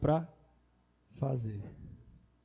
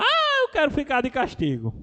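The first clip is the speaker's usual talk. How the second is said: imitated child's shouting; 10 semitones higher, 5 dB louder than usual. Nephew playfully demanding punishment from uncle, imitated shouting. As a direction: holler it like a playful little boy. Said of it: "Aí ele começava a gritar".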